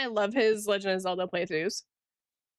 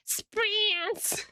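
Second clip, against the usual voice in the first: squeaky high shriek of a name; high-pitched Zelda voice; 11 semitones higher than usual. Retold or imitated. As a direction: shriek the name like a cartoon princess. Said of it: "whenever Zelda sees him, he goes".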